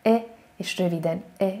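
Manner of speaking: E said short with the mouth only a little open; short French E. Shown as a demonstration. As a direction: clip the E short and nearly closed. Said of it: "a száj csak egy kicsit van nyitva".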